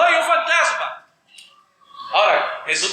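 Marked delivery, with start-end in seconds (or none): 0.0-1.1 high mock scream of fright; a hammed-up ghost scare, much higher; mock frightened cry. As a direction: squeal it like scared children.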